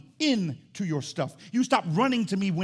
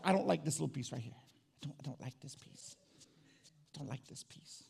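hushed private complaint; 11 dB quieter, slower than usual. Acted. Person privately asking God to fix the body, hushed. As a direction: grumble it under your breath to God.

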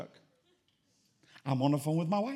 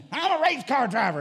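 higher, louder Southern boast; 6 semitones higher, 7 dB louder than usual. Retold or imitated. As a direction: puff up the Southern brag even more. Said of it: "he was a Southerner to the core".

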